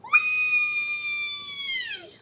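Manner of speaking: long high squealed whee; fly off the swing with joy. Acted. Hippo swinging high, squealing whee.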